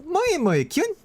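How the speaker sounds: squeaky high anime moe chant. Shown as a demonstration. Streamer performs the cute line for chat, very high. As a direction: push it way up, cute and bouncy. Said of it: "like, higher pitch?".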